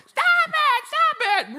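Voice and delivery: high, loud mock yell; annoyed little sister. Sister on the couch to roaring brother, annoyed yell. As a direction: screech it at a pestering brother.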